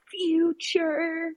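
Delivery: high, drawn-out cartoon wail on one word; SpongeBob. Retelling a panic attack as a cartoon scene, mock cartoon wail. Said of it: "like, in SpongeBob where it's".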